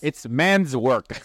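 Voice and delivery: slow, drawn-out mock tough-guy line; mock macho. Pitmaster joking about gritty barbecue work, mock macho. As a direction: ham up the manly man, stretching each word.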